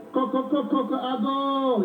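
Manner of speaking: spoken knocks, then long held call; visitor knocking. Acted. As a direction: rap out the syllables, then sustain the last word. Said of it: "You knock somebody's house".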